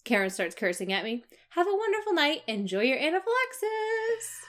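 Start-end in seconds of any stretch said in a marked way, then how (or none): 1.5-4.4 high, sugary fake-polite farewell; sweetly twist the knife; sarcastic sweet sign-off.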